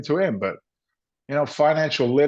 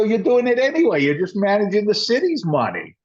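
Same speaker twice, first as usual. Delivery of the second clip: animated insistence; 10 semitones higher than usual. Retold or imitated. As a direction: press the point hard. Retold argument with friend.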